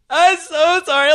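high mock-tearful wail; retold crying. Crying driver apologizes to police officer, mock tearful. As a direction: imitate a weepy apology. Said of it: "I'm crying and everything".

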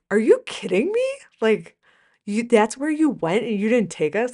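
high, loud mock-indignant child; indignant kid. Little son upset he missed Cancun, hammed-up indignant child. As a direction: voice the little one's outrage, hammed-up. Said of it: "My little one was like".

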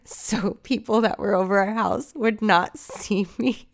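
talking through giggles; laughing. Real, not acted. Telling how mom hid her from people, laughing. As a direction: let laughter break into the words. Laughing at own embarrassing memory.

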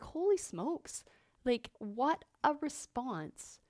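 stunned at your own reaction; retold amazement. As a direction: draw it out in mild astonishment. Her amazed reaction to her panic.

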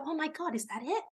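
quietly acted anticlimax; underwhelmed reaction. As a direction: say it softly, as if let down. Acted reaction after going live.

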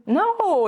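"No" high and drawn out; emphatic no. Real, not acted. Coach reassuring that honesty isn't terrible, emphatic high "No". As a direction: stretch "No" out on a high swooping pitch. Emphatically answering own question.

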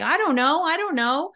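high, repeated unsure I don't know; unsure client. Retold or imitated. Client stuck when asked where, high and unsure.

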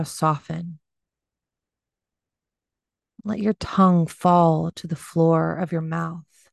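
unhurried meditation voice with long pauses; gently pace them through breath and body, leaving space; guided meditation. Acted. Leads a body scan meditation.

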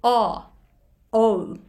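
"O" said as a round, pure "oh"; teacher demonstrates the "oh" vowel of bowl. Shown as a demonstration. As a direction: hold "O" with rounded lips. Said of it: "Oっていう母音".

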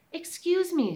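put-on high, sweet child voice; polite little girl asking. Girl speaks to hedgehog.

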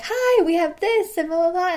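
beam at the customer as you start; excited pitch. Retold or imitated. Clerk pitching to people waiting in line, cheerful.

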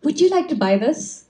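high, eager imitation of a child; enthusiastic young seller. Eight-year-old boy selling bubbles to visitors, enthusiastic. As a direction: pitch it up like a keen little vendor. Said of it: "He walks us to very enthusiastically".

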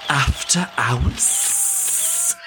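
very drawn-out Scouse podcast welcome; Scouse accent. Acted. Host doing the proper podcast intro, slow Scouse accent. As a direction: stretch each word in exaggerated Scouse, rising. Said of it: "proper podcast intro in Scouse".

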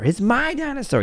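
put-on very high child's squeal; possessive kid. Younger brother grabbing the dinosaur, high squeal. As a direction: shriek it like a grabby toddler.

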